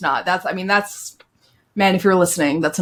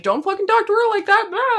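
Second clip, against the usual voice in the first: high-pitched mock telling-off; hammed-up indignant yell, pitch way up without much volume; imitated yelling. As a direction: yell it high, imitating your own outburst. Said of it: "yelling at people".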